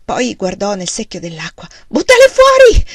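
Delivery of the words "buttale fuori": high, loud frantic shout. A narrator's reading. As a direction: yell the order in panic. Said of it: "gridò". (grido frenetico)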